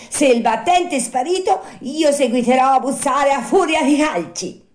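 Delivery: high, loud puppet fury; enraged shout. Acted. Pinocchio vowing to kick the door, furious high shout. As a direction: threaten loudly, blind with anger. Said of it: "sempre più accecato dalla collera".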